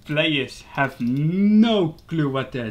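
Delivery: loud, drawn-out stress on "no"; emphatic exasperation. Real, not acted. Player mocking clueless opponents, emphatic exasperation. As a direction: stretch "no" long and loud.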